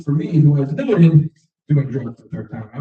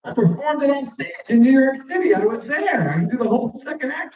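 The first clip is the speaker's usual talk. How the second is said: higher, proud brag; indignant boast, higher pitched; boastful; 10 semitones higher than usual. Retold or imitated. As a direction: puff up with pride. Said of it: "And I said".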